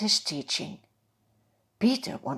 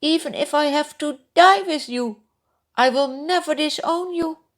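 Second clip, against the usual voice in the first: loud, higher earnest vow; insisting; 8 semitones higher, 6 dB louder than usual. Acted. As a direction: swear it with all his heart. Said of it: "Peter insisted".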